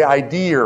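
"idea" ending in an R, Boston style; Boston "idea" with intrusive R; Boston accent. Shown as a demonstration. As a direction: say "idea" as "idear". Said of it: "I'm from Boston when I say idea".

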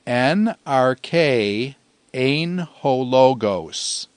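slow, measured Greek for repeating along; say-along recitation. Shown as a demonstration. Teacher leading students through the verse in Greek, slowly recited. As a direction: recite word by word, leaving small gaps. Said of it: "And once again".

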